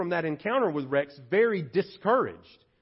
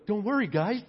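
mock cheery pep talk in a higher voice; hammed-up higher-pitched cheerleader; Barnabas; 4 semitones higher than usual. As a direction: chirp empty encouragement at the guys. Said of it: "Barnabas didn't just walk around going".

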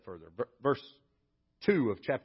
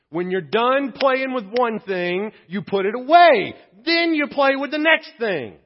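put-on instructing kids; 10 semitones higher, 11 dB louder, slower than usual. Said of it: "tell my kids".

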